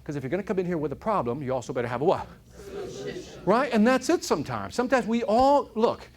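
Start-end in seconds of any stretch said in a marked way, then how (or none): 2.6-3.2 soft whispered answer. Real, not acted. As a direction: breathe the word out.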